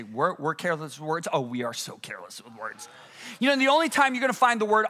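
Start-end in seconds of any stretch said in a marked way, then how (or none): 0.0-2.9 a quiet doubting echo, then a knowing answer; low, quiet aside. Acted. Preacher echoing a doubting listener, low aside. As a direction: drop lower and softer.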